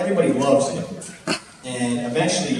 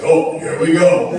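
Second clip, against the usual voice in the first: put-on slow, drawn-out cocky drunk, louder; 5 semitones higher, 9 dB louder, slower than usual. Drunk guy squaring up to security, slow and cocky.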